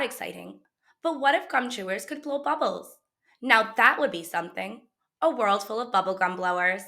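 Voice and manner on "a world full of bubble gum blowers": plain reading voice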